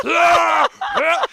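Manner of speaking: repeated high, loud retches; comic throwing-up noises; imitated puking. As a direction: heave like someone vomiting mid-laugh. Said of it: "Well, she's like".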